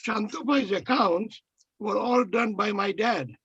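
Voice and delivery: ordinary delivery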